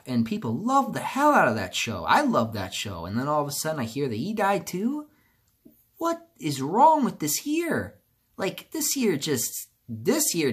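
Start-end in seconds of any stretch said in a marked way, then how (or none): 4.7-9.7 higher, slow disbelieving complaint to the sky; exasperated outburst. Real, not acted. Venting to viewers about a year of deaths, high and slow with exasperation. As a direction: ask the heavens in slow, pitched-up disbelief. Fed up after another death.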